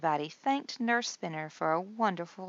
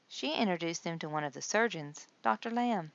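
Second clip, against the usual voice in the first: ordinary delivery, nothing marked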